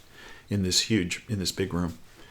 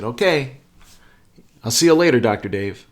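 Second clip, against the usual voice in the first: called-out farewell; 6 dB louder than usual. Retold or imitated. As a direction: call out a goodbye, dropping on the name. Acted hearty parting at hotel.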